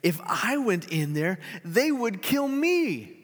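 rising, slow, high fearful protest. Acted. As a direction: plead that it means death. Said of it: "but Gladys protested".

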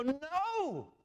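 high, drawn-out cry of protest; mock scream. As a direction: cry it out high and long, as if fed up. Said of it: "jump up and down and scream".

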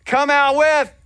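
loud, high command to surrender; police shout. Shown as a demonstration. Pastor showing the congregation the surrender call, shouted. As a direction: bark it like a cop at a standoff. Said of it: "I want you to shout it out now".

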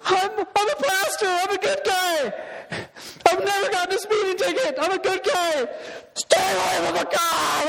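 high scared rambling plea; panicked pleading. Retold or imitated. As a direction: plead in a terrified squeal. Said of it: "you know what I said?".

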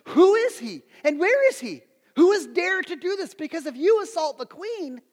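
high, loud, outraged demand; angry king. Acted. Preacher voices the king raging at Esther's news, angry. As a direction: thunder it out as the furious king. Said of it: "King's getting angry. He's mad".

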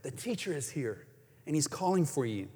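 low, soft private word; a quiet aside from Martha to Mary, soft and low; said privately. A narrator's reading. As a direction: murmur it to your sister. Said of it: "saying in private".

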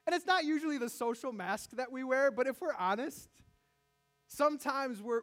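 ordinary talk

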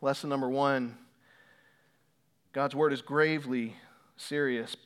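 ordinary talk

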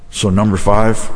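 normal talking voice

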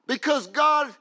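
loud, high, rhythmic shouted preaching; full-force preaching. Acted. Preacher building to the climax, shouting each phrase. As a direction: pound each phrase home to the congregation.

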